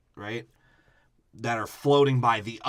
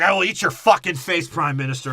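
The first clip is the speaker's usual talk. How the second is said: menacing; 6 semitones higher, 8 dB louder than usual. Retold or imitated. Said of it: "And Hannibal's like".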